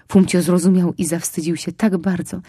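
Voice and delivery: ordinary delivery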